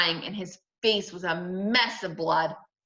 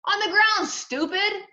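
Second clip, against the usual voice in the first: high, impatient put-down; exasperated retort; 13 semitones higher, 4 dB louder than usual. A narrator's reading. Brother telling Sheila where to look, exasperated. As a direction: answer as if it is obvious, annoyed.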